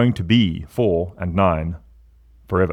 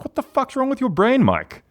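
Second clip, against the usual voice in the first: exasperated self-scolding. Retold or imitated. Scolding himself in retold self-talk.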